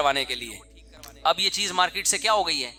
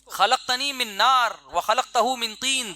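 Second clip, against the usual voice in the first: slow recitation; 3 semitones higher, slower than usual. Acted. Quoting Satan's Quranic argument.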